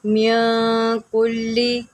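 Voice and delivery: मिन कुल्ली with the n held in the nose; nasal ghunna. Shown as a demonstration. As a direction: hum the n of मिन before कुल्ली. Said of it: "यहाँ पर आप गुन्ना करें".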